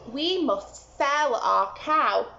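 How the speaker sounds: stern mother giving orders; mother's voice. Acted. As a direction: tell Jack firmly what to do. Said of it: "Jack's mother said".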